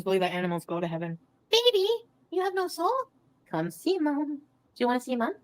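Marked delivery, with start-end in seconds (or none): 1.5-5.3 high-pitched coaxing coo; high, doting pet voice. Real, not acted. Talking to a pet, calling it over.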